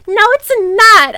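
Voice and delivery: high, loud mock outburst; hammed-up angry wail. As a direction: snap back high and loud. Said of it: "I said angrily".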